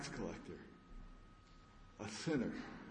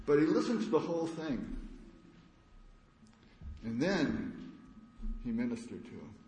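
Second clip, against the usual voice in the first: ordinary talk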